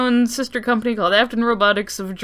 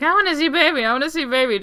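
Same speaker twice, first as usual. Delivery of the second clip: put-on whiny child voice; 5 semitones higher, faster than usual. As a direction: beg like a spoiled kid. Child Debbie begging her dad.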